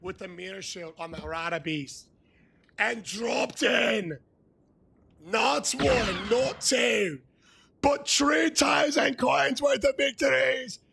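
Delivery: high excitement building to a slow shout; building hype. Real, not acted. Streamer bragging about Cody's win, building to a shout. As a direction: build it up to a big shouted reveal. Hyping Cody's big coin drop.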